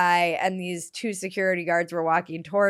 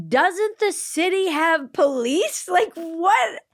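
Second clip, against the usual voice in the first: higher, baffled retelling of her own reaction; incredulous outburst; 9 semitones higher, slower than usual. Retold or imitated. Telling the podcast host about private security, baffled and raised. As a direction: pitch up in mock disbelief. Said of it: "But I'm like".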